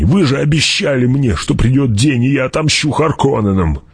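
high, urgent appeal; pleading. Acted. Gurney begs his lord for promised revenge, pleading. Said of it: "взмолился Гурни".

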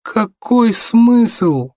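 put-on high, very drawn-out undead boy; slow zombie child's wail; protesting son. A narrator's reading. Undead son argues with parents.

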